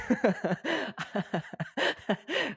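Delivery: high, bursting laugh; big laugh. Real, not acted. Laughs after announcing a new topic.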